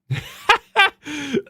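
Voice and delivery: bright, loud laugh of disbelief; high laugh. Real, not acted. Streamer laughing before showing the same scene, loud high laugh. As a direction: crack up at the comparison.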